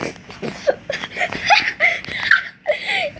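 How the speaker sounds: high, breathless cackling laugh; high cackle of mocking laughter; shrill cackle. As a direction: cackle like a mad girl. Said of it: "She started to laugh".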